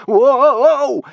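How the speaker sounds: high yelp of alarm as the rope swings; sharp gasping whoa; startled gasp. A narrator's reading. Hiccup swinging away on the rope, yelps in alarm. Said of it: "gasped Hiccup".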